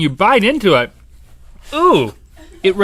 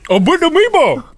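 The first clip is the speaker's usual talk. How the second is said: excited; 13 semitones higher, 5 dB louder than usual. Real, not acted. Echoing excitedly.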